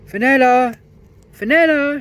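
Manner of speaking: very high, very loud call; shouting a name. A narrator's reading. Peter calling for the lost princess, shouting. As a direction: call out across the glade. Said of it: "shouted Peter".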